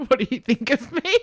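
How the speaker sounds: high-pitched, giggly needy plea; high and needy, breaking into laughter; mock needy plea. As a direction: beg for approval as a joke, half laughing.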